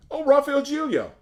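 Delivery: loud, high-pitched cry of recognition; delighted exclamation. Real, not acted. Singer seeing who phoned mid-interview, surprised exclamation.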